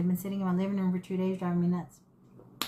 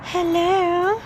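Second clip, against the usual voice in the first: high, louder sing-song hello; pet greeting; 9 semitones higher, 5 dB louder than usual. Real, not acted.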